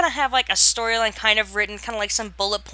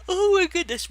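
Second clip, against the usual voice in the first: words stretched by a yawn; 10 semitones higher than usual. Real, not acted. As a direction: talk through a yawn. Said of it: "why am I yawning?".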